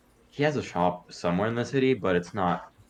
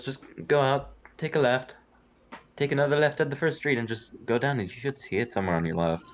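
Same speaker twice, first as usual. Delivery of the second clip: halfling voice; 6 semitones higher than usual. Acted. Halfling gives directions.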